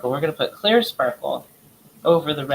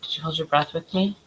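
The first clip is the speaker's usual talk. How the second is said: ordinary talk, nothing marked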